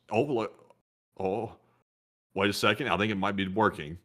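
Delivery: ordinary talk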